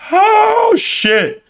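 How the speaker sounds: long high "oh", then dropping; shocked. Real, not acted. Streamer seeing a viewer down ninety-two percent, high shocked outburst. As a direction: cry out in amazement at a huge loss. Reacts to viewer's huge loss.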